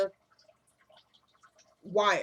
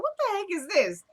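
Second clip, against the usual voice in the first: higher-pitched disbelief; incredulous reaction; 8 semitones higher than usual. Retold or imitated. Recapper reacting to the forced apology, incredulous. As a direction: retell it as baffled outrage.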